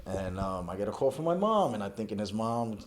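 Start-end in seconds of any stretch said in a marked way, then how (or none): none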